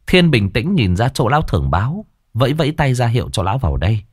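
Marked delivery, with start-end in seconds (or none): none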